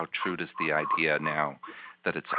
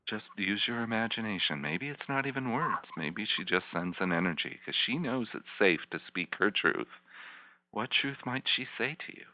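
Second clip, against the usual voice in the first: soft, higher coaxing voice; guided visualization; 5 semitones higher, 3 dB quieter than usual. Acted. Therapist coaxing a tearful client to keep imagining, soft. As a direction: gently encourage her to keep imagining.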